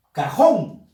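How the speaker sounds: "cajón" with the force on "jón"; stress on last syllable. Shown as a demonstration. Teacher presenting the next example, stress on "jón". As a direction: punch the final "jón" of "cajón". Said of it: "Fuerza de voz en la sílaba jón".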